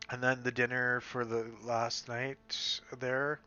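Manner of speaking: ordinary talk, nothing marked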